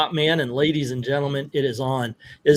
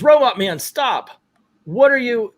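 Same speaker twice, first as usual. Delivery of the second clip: raised, higher voice, mock alarm; acted alarmed cry; 8 semitones higher than usual. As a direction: call out as if to halt an attacker. Hero cries out at attacker.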